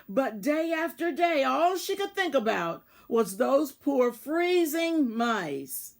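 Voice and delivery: ordinary delivery, nothing marked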